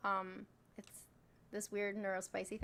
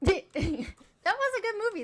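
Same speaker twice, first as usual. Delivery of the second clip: laughing; 9 semitones higher, 9 dB louder, slower than usual. Real, not acted. Laughing high slow.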